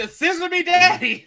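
very high, playful mock cry; hammed-up catchphrase. Storyteller joking with co-hosts about the valets, hammed-up catchphrase.

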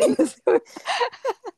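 tickled laugh at a flattering memory; high delighted laugh. Real, not acted. Laughing high after retelling an old lady's compliment.

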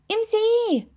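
high, excited exclamation. A narrator's reading. Toe telling the sleepy witch where it glows, excited. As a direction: cry it out high and impatiently.

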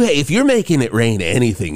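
ordinary talk, nothing marked

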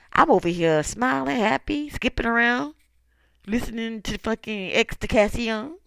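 bright, loud and carefree; gleeful boast. Real, not acted. To haters, bragging she's happy, loud and gleeful. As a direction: show off how happy you are.

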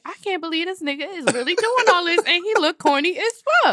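mock disbelief. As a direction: play up the scandalised inner thought. Acts amused inner reaction to date.

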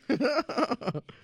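loud high-pitched laugh. Real, not acted. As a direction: burst out laughing. Laughs at recording joke.

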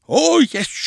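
high, loud burst; emphatic contradiction. A narrator's reading. Grandpa contradicting his grandchild, loud and emphatic. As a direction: pounce on the denial.

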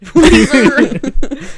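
laughing out the word, high. Real, not acted. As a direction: crack up mid-word. Laughing at the [name] joke.

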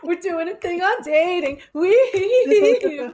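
imitating a chirpy podcast opener; mock bubbly greeting. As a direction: gush it like an over-excited host. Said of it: "expect me to come to call like".

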